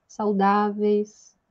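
usual speaking voice

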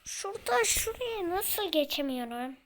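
loud, exasperated shout at the game; frustrated outburst. Real, not acted. Player yelling at the game he can't get past, loud and frustrated. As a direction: yell it out, fed up and stuck.

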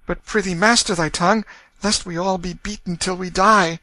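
put-on high, pitying young girl's voice; worried sister. A narrator's reading. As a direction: soothe and warn your mad brother. Sister Nan voice.

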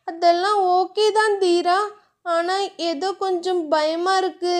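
a hint of a nervous wife confiding; Diya voice. A narrator's reading. Diya admits fear.

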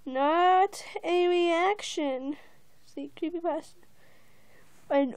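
usual speaking voice

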